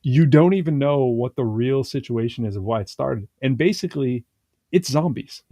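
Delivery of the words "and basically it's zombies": ordinary talk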